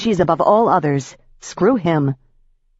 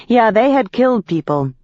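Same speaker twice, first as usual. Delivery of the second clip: ordinary delivery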